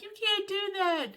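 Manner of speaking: high, tearful protest; a mild imitation of a tearful protest; crying. Husband suggests sixth fueling, she protests with high tearful voice. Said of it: "I'm, like, crying".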